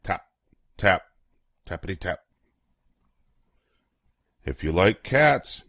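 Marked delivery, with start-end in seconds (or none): none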